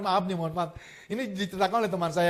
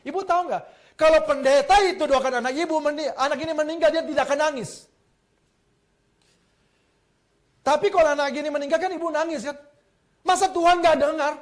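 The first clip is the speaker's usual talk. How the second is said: raised pitch, a touch louder, pressing the mother; urgent pleading; 7 semitones higher, 3 dB louder than usual. Retold or imitated. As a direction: urge her to keep crying to God. Pastor urges crying mother. (pendeta mendesak ibu yang menangis, nada tinggi)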